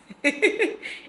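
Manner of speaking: short high giggle; quick bright laugh; light giggle. Real, not acted. Laughs after intro.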